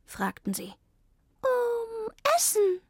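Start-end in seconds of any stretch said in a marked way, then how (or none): 1.4-2.8 high, slow cat voice; drawn-out high cat. A narrator's reading. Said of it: "sagte Zelda".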